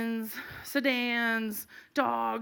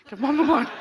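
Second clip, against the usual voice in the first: raised, strained urgent order; urgent demand; 4 semitones higher, 6 dB louder than usual. Retold or imitated. Mom demands the blunt as officer approaches, urgent and loud. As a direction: insist on it, louder and higher.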